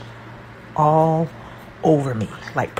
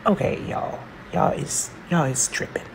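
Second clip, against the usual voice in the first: hushed aside; 5 dB quieter than usual. Real, not acted. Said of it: "whispered aside".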